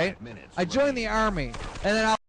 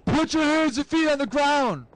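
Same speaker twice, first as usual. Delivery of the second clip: police command; 8 semitones higher, 3 dB louder than usual. Retold or imitated. Cop orders a suspect to the ground, high commanding call. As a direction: call it out like an officer making an arrest. Cop's order acted in story.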